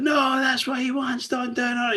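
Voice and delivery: high frantic warning voice; frantic Starscream. Retold or imitated. Starscream warning the Maximals, frantic. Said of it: "He's once again frantic".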